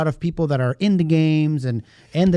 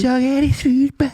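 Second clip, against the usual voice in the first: funny voice; 10 semitones higher, 3 dB louder than usual. Acted. Echoing the show name in a voice.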